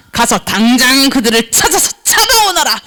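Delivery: commanding king. Acted. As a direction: issue an urgent order as a king. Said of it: "왕이 명령했어요". (왕처럼 낮고 위엄 있게 명령)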